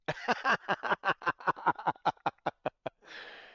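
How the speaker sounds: a loud, real burst of laughter; hearty laugh. Real, not acted. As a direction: laugh it out at your own joke. Laughs at his own joke.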